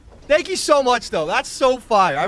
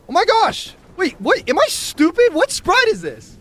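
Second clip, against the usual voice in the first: surprised; 10 semitones higher, 5 dB louder than usual. Real, not acted. Opening a gift, surprised.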